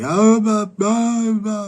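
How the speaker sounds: high, loud squawking blah blah; mock angry bickering. Mocking the neighbors' quarrel to viewers, high loud squawk. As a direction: parody the neighbors arguing, shrill and loud. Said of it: "they're starting to get angry".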